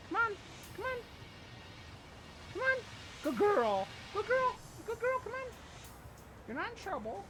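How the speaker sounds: sweet dog-talk voice. Real, not acted. Owner coaxing shivering poodle with treat, high soft voice. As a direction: coax a nervous dog over gently.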